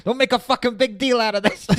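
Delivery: mock yell. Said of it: "he's like yelling at him".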